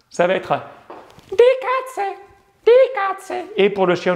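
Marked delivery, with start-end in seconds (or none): 1.3-3.4 very high, small voice; high little voice. Shown as a demonstration. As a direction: squeak it out tiny and high. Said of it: "la petite voix beaucoup plus aiguë".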